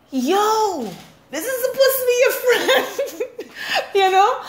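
high, laughing, half-incredulous; laughing disbelief. Real, not acted. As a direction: laugh at your own nerve.

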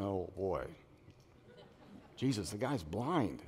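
low, soft awkward aside; muted dismay. Acted. Disciples awkwardly pointing out the obvious to Jesus, muted dismay. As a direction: drop the voice, mock embarrassed on someone's behalf.

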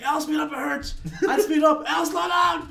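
high-pitched, frantic contradictory orders; mock whining passenger. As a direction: imitate a friend in pain nagging the driver. Imitating injured passenger's contradictory orders.

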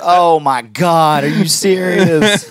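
slow, stretched-out mock outrage; mock disbelief. Receptionist finding out about the prank, mock disbelief. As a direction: draw it out in incredulous imitation.

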